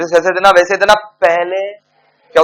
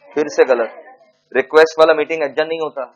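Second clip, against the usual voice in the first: normal talking voice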